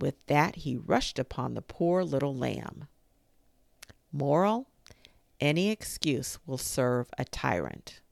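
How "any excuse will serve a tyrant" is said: ordinary delivery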